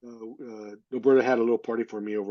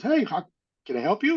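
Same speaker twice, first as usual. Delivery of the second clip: friendly shopkeeper; 8 semitones higher than usual. Retold or imitated. Shopkeeper greeting a customer.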